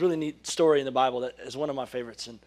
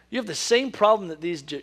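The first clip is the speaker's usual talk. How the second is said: ordinary delivery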